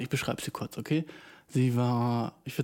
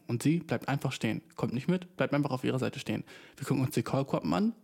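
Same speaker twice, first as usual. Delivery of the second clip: ordinary talk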